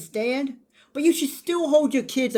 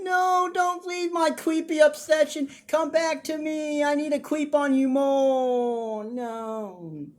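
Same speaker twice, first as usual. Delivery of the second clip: slow, higher mock-pleading voice; mocking Thomas; 6 semitones higher, slower than usual. Thomas begging Hope not to leave, slow mock plea. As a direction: drag it out like a clingy creep begging. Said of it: "He's like".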